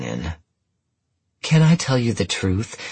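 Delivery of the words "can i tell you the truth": put-on bright, eager American accent. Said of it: "she asked the examiner in an American accent".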